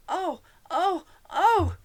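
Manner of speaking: high, loud cries of pain; pained shout. A narrator's reading. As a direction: yelp out loud as if hurt. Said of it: "shouted the Queen".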